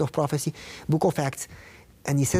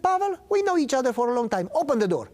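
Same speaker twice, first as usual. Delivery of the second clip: a lightly voiced friend, higher and coaxing; Greg Budd pleading; 9 semitones higher, faster than usual. Old friend Greg coaxing him to open up, higher. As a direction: press gently through a locked door. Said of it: "He said".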